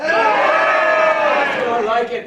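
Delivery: slow, loud, slurred-out shout; mock drunk shout. Host answering the crowd with a hammed-up drunk shout. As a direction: bellow it like a drunk.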